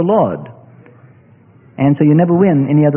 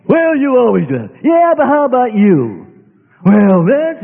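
higher, testy back-and-forth of spouses; mock bickering couple; 5 semitones higher than usual. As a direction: play a petty marital spat. Acted spouses' quarrel.

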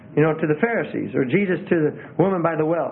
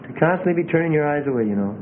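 ordinary talk, nothing marked

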